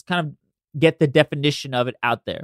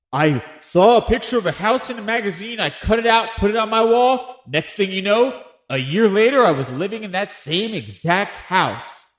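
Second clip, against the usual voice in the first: higher, hammed-up earnest believer; mocking testimonial; 6 semitones higher than usual. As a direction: parody a starry-eyed true believer. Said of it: "where you have people like".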